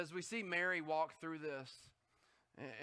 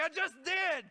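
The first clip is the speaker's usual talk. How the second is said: a pitched-up, louder mock shout at the self-checkout; half-hearted yell at a self-checkout; mock yelling at machine; 7 semitones higher, 4 dB louder than usual. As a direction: snap back at the machine in high mock anger. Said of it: "you're screaming at it".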